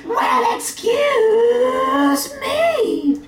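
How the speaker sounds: high, slow indignant falsetto; huffy Monty Python lady; bad woman's voice. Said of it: "a bad woman's voice".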